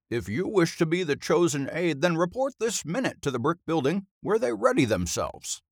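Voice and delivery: high, formal oration; pompous headmaster. A narrator's reading. Drinking headmaster formally addresses pupil on the stage, pompous. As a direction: address the boy grandly and formally.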